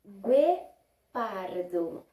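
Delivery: "Guepardo" slowly, with gw; slow demo. Shown as a demonstration. Teacher reads an animal name slowly to pupils. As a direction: draw out "Guepardo" for the class. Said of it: "se eu escrever, sem o U".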